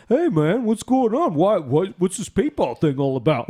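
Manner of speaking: mock vendor voice. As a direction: play the innocent vendor calling back.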